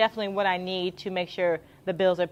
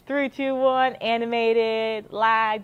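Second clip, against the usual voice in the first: louder, higher countdown into the mic; hype announcer countdown; 4 semitones higher, 5 dB louder, much slower than usual. Real, not acted. Announcing the car race to families, loud and hyped. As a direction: pump up the crowd at the race start. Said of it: "getting the people excited".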